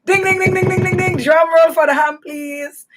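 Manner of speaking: high chiming ding ding ding; game show bell. Pretend. Host hailing the ham answer, mock game show excitement. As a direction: ring it out like a quiz winner bell.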